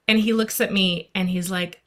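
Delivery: normal talking voice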